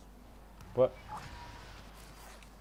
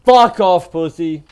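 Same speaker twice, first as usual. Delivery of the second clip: loud, high furious yell; angry yell; 5 semitones higher, 12 dB louder than usual. Real, not acted. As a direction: yell it out in a rage. Rages after being killed.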